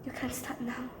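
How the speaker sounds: a hushed aside to fellow plotters; mock whisper. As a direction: say it softly, as a retold whisper. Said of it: "and I whisper".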